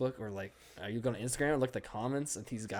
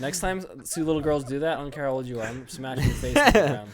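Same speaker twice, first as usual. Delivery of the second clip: mock angry threat; 4 semitones higher, 9 dB louder than usual. Mock threat about kicking girls.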